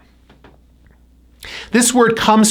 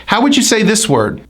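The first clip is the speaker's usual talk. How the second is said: ordinary talk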